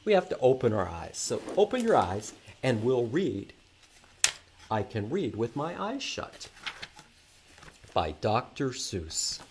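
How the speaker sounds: normal talking voice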